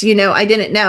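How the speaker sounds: ordinary talk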